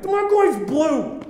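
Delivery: high, loud indignant outburst; angry mode. Retold or imitated. Irate customer complaining at the service desk, high angry outburst. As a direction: blurt it out, irrationally angry. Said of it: "My brain clicks over to angry mode".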